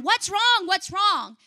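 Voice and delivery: high, loud frantic cry; retold scream. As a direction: yell it out in panic, reliving it. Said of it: "And I'm screaming".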